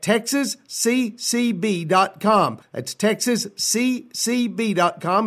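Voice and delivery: slow, letter by letter "texas C C B dot com"; slow spelled-out address. Shown as a demonstration. Host spelling the web address slowly for listeners. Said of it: "as in Texas Cowboys Christmas Ball".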